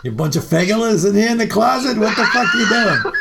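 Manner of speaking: high, loud laughing yell; retelling Leslie's outburst with a laugh; mock shout. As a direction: yell it half laughing, brash and rude. Leslie yelling at band.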